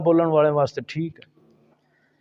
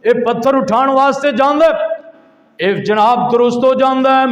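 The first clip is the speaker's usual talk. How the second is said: loud, high, driving sermon narration; fiery preaching; 8 semitones higher, 6 dB louder than usual. Acted. Preacher retelling the Prophet's vision, fiery preaching. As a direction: tell it forcefully, phrase after phrase.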